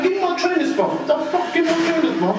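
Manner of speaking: high, urgent demanding shout. Real, not acted. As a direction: yell it out, desperate. Detainee demanding his shoes.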